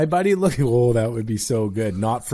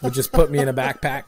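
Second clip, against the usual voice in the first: ordinary talk, nothing marked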